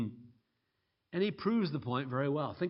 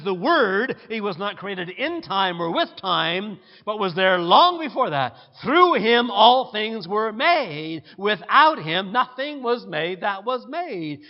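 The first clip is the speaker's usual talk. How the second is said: loud, emphatic scripture reading; preaching scripture; 3 semitones higher, 9 dB louder than usual. Real, not acted. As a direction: preach the verses at full force. Preacher reads scripture with force.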